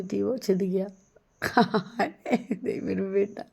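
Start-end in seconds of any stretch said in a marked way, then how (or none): 1.4-2.6 a short burst of real laughter. Real, not acted. Mother laughing after her daughter's ear is pierced, real laugh.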